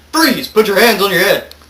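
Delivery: loud, high order at gunpoint; shouted command. Acted. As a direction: shout it like a cop making an arrest. Story hero holds leader at gunpoint.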